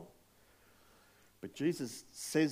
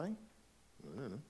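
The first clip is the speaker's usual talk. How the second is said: slow, stretched-out, unsure I don't know; drawn-out uncertainty; 8 dB quieter, much slower than usual. Shown as a demonstration. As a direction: let the words hang as if truly unsure. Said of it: "Are they?".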